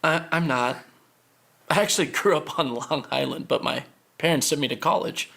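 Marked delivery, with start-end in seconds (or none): none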